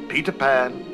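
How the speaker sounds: plain reading voice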